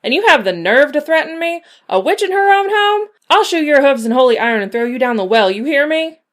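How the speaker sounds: high, loud furious shouting; furious threat. A narrator's reading. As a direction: scream the threat at him, rising to a shriek. Witch threatens devil.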